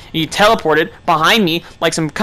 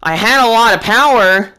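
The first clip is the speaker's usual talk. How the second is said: ordinary talk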